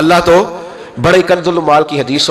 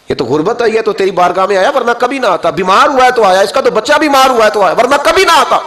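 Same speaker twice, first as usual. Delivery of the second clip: higher and louder with each ورنہ کبھی نہ آتا; a rising charge against a liar; accusing angels building; 8 semitones higher, 3 dB louder than usual. Acted. (فرشتوں کا بڑھتا ہوا الزام)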